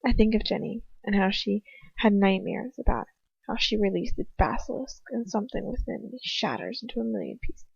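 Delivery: usual speaking voice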